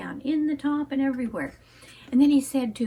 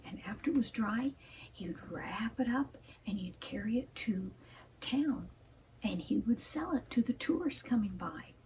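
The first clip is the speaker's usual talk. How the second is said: soft hushed whisper, telling a tale; bedtime-story whisper; whispered storytelling; 3 semitones lower, 9 dB quieter than usual. A narrator's reading.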